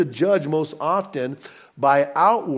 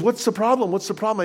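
higher, brisk flight attendant asking; stewardess asking; 6 semitones higher, faster than usual. Retold or imitated. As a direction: quiz the passengers briskly. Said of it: "she says".

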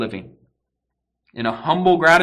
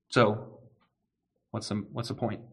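dismissive antinomian; 2 semitones lower, 10 dB quieter than usual. Acted. Preacher voicing a sinner excusing himself, quietly dismissive. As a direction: let it trail away as a self-excusing sinner. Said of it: "the deceiving heart of an antinomian".

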